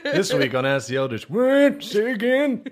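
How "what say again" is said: lightly voiced elder, pitched high; high, questioning old-timer who didn't catch it; mock-deaf high-pitched query; hard-of-hearing elder.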